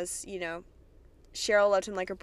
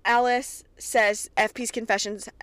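ordinary delivery

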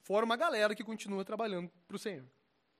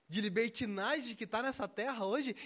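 usual speaking voice

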